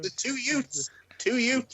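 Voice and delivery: high-pitched movie-line imitation; My Cousin Vinny quote. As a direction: quote the film, "youths" turned to "Utes". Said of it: "watching more My Cousin Vinny".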